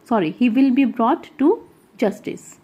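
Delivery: ordinary talk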